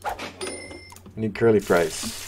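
slow, soft, drawn-out I need curly fries. Real, not acted. Gamer wearily calling for fries, slow and soft. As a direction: stretch the words out slowly.

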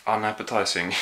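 normal talking voice

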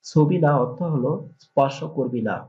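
normal talking voice

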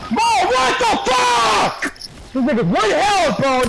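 high, drawn-out disbelieving yell; shocked shout. Real, not acted. Shocked outburst at teammate.

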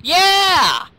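high, loud whoop of yeah; triumphant shout; cheering. Real, not acted. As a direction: cheer it out for the team. Cheering on team in game.

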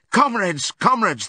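high, urgent call; pleading shout. A narrator's reading. Animals appealing to the horses pulling the van, pleading shout. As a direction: shout to them in desperation. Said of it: "they shouted".

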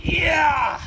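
high, loud shout of victory; triumphant yell. Real, not acted. Streamer gets the part on, triumphant yell. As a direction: holler it in triumph. Tool finally goes on.

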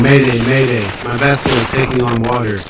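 crackly radio distress call; urgent call over the radio; mayday radio call. Retold or imitated.

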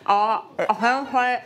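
cork in mouth; slurred around a mouthful; mouth full. Real, not acted. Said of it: "das spricht eher undeutlich".

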